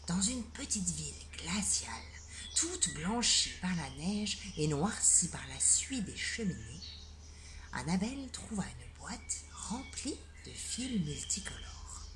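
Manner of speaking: hushed storytelling whisper; bedtime-story whisper; whispered narration. A narrator's reading.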